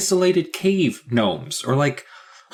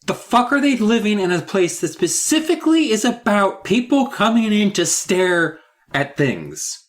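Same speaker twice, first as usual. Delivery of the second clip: ordinary talk, nothing marked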